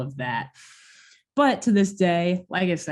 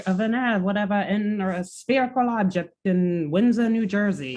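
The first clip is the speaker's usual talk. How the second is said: put-on higher old-timey radio announcer; 1950s narrator; 4 semitones higher than usual. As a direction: read it like an old-timey breaking news bulletin. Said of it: "old-timey radio voice".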